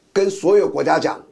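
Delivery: ordinary delivery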